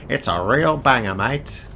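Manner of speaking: hammed-up Australian accent with "mate". Streamer repeating the plug on purpose, exaggerated Australian accent. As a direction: lean harder into a mock Australian accent. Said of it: "said it in an Australian accent".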